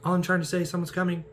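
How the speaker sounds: Iron Man scolding. Retold or imitated. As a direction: snap at the kid like a fed-up dad. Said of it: "Iron Man interrupts him. He's just like".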